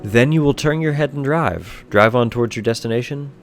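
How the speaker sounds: ordinary delivery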